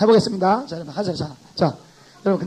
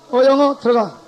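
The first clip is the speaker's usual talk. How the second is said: loud, high barking gate guard; gatekeeper; 5 semitones higher, 9 dB louder than usual. Shown as a demonstration. Teacher plays a gatekeeper turning away French speakers: loud barking guard. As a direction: bark at each visitor like a stern gatekeeper. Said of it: "한번 시작".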